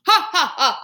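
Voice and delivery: loud, high booming laugh; roaring laugh. Acted. Janitor laughing at his own joke, roaring. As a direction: laugh big and hearty. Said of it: "roared the biggest roar of laughter".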